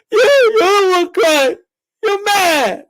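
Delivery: high, drawn-out whiny wail; mock crying troll. Streamer shows how trolls be in the chat, mock crying. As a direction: imitate a bawling troll, hammed up. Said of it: "this is how trolls be, like, in the chat".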